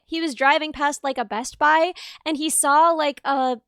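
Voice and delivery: normal talking voice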